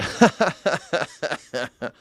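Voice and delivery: hammed-up condescending laugh; mock villain chuckle. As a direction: chuckle on and on like a pleased colonist.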